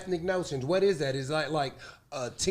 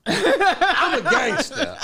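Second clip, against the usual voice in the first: higher, drawn-out words through laughter; laughing; 8 semitones higher, 4 dB louder, much slower than usual. Pretend. Host jokingly defending his gangster image, laughing. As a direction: claim toughness while cracking up. Mock tough claim while cracking up.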